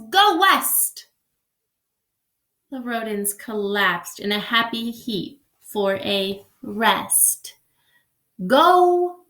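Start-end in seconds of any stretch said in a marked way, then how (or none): 0.1-1.1 loud, high, slow call; strong voice. Acted. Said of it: "In a voice so strong". 8.5-9.3 loud call. Shown as a demonstration. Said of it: "how Wadney is acting now".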